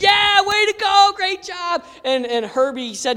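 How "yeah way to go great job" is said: high-pitched trainer's cheer; imitated jubilant whoop, much higher; excited cheer. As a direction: cheer on your winning fighter.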